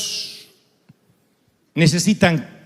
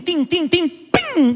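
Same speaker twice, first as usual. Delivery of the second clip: pebble pings; 9 semitones higher, much slower than usual. Retold or imitated. Imitating stones hitting windshield.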